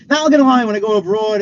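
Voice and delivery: high, loud burst easing off; sudden loud outburst. Real, not acted. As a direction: blurt it out at full voice. Bursts out after the whisper.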